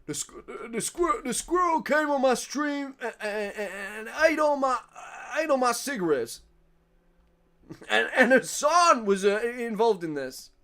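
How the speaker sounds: lightly voiced Asmongold rant about a squirrel; Asmongold impression. Streamer imitating Asmongold telling of a squirrel on stream. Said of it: "imagining Asmongold, like, talking about".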